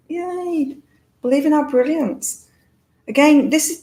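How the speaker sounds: normal talking voice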